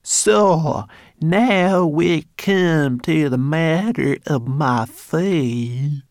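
a snooty, drawn-out parody; imitating Gilbert. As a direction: drag it out in a mocking put-on voice. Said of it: "She imitated Gilbert's voice".

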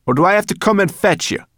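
high, loud threat; spat threat. Acted. Brownie threatening the hidden boy, high and loud. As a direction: threaten to drag them out. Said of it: "spat Sorrel again".

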